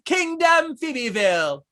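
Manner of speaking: put-on high, slow, loud royal toddler; baby Phoebe voice. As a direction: proclaim it like a bossy little princess. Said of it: "you do such a great baby Phoebe voice".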